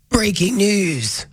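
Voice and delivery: slow, drawn-out news announcer; in character. Pretend. Host getting in character before reading a campaign statement, mock announcer. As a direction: stretch out a mock breaking-news intro. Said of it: "I'm gonna get in character".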